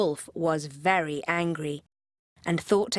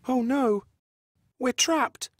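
higher, a touch alarmed; frightened little pig; 5 semitones higher, slower than usual. Acted. Smallest pig seeing the wolf outside, mildly afraid. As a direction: panic lightly, up high.

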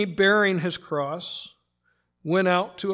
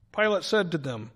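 usual speaking voice

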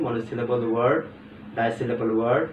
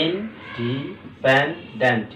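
"Independent" slowly split, each syllable drawn out; much slower than usual. Shown as a demonstration. Teacher going over the division for students, syllable by syllable. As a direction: divide "independent" into stretched parts. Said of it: "খেয়াল করো".

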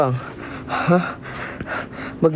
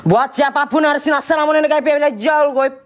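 higher-pitched, ringing challenge to unseen spirits; spirit-summoning call; 9 semitones higher than usual. Acted. Ghost hunter addressing unseen spirits, summoning call.

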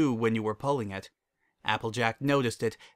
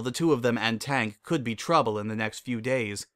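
usual speaking voice

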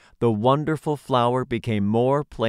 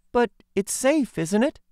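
put-on high, girlish questioning voice; young girl voice; 12 semitones higher than usual. A narrator's reading. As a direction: ask it as an eager little girl. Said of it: "inquired the girl".

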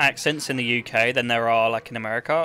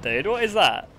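ordinary delivery

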